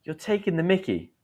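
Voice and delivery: a hint of an exasperated boss; boss complaining. Boss to late employee, mildly indignant. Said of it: "Your boss can say".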